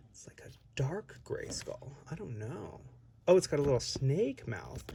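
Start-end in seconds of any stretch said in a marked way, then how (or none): 0.1-2.8 hushed, peering whisper. Real, not acted. Squinting at tiny box art in a hushed whisper. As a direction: breathe it as you lean in close.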